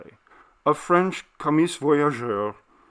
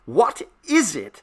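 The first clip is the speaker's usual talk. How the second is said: high, louder alarmed cry; cried out; 7 semitones higher, 5 dB louder than usual. Acted. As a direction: demand an answer in alarm. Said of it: "I cried, hardly less loud".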